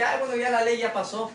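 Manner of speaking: mock a careless believer, brushing it off; hammed-up dismissive sigh-voice; dismissive mock quote. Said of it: "No es que".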